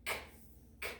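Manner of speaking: "K" as a bare voiceless click, twice; letter sound. Shown as a demonstration. Teacher showing the K sound, "K" as short "kh". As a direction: say "K" as its quick consonant sound, separately. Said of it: "Make the K sound".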